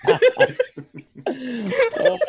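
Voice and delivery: high, loud burst of laughter; laughing hard. Real, not acted. Cohost hearing the scam-caller accent, real high laughter. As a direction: crack up helplessly.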